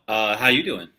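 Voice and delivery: ordinary talk, nothing marked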